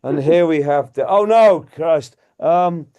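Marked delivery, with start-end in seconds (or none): 1.1-2.2 startled. Real, not acted. Sudden dismay at prop.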